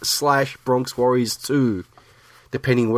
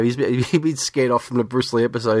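ordinary talk

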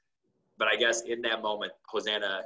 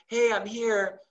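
cheerful helper; 12 semitones higher, 3 dB louder, slower than usual. Retold or imitated. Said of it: "She's like".

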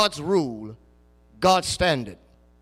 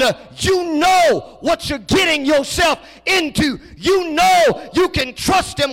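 loud, high shouted preaching, drawn out at the end; preaching at full force; 9 semitones higher, 10 dB louder, slower than usual. Acted. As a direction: thunder it at the congregation.

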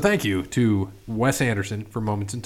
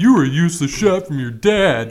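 imitating Anthony; 5 semitones higher, 5 dB louder than usual. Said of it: "best thing I've ever heard Anthony say".

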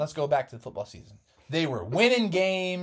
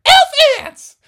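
high, squeaky cheerful mom voice; giddy team mom; 18 dB louder than usual. Acted. Missus Frogerson cheering the boys on, high and giddy. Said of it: "And she's like".

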